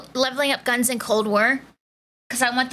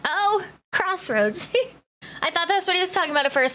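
ordinary talk, nothing marked

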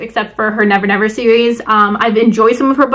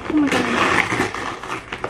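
breathless whisper; 7 dB quieter, much slower than usual. Real, not acted. Awed at the package.

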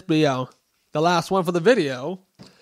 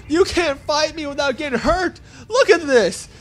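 high-pitched gloating through laughter; laughing taunt; 9 semitones higher than usual. Real, not acted. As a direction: taunt them while laughing. Laughing while taunting enemies.

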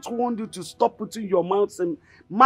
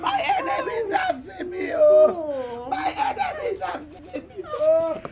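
crying. Acted. Mother laments her only son's arrest, wailing. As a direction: wail helplessly, stretching out the cries. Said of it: "You must stop crying".

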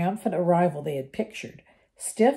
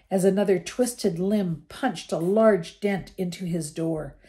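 plain reading voice

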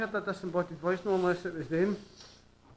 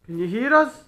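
loud questioning call, rising at the end; 2 semitones higher, 8 dB louder than usual. Real, not acted. Ghost hunter asks spirits if they hear, calling out. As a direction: call into an empty building for an answer.

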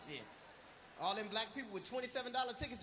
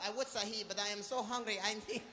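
put-on lightly raised Indian accent, pleading; mock Indian accent; 3 semitones higher than usual. Hungry soldier begging the general for food, Indian accent.

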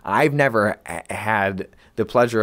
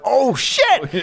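high, hammed-up dismay; retold shocked reaction, pitch way up; acted alarm; 14 semitones higher than usual. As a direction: exclaim it like a fight is starting. Acts out own reaction to confrontation.

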